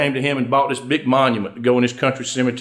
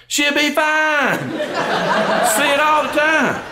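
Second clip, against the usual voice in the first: very high, stretched-out reassurance; drawn-out punchline; 10 semitones higher, much slower than usual. Acted. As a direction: sing it out high and slow, milking each word. Punchline bursting the self-important husband's illusion.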